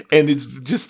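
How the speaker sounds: normal talking voice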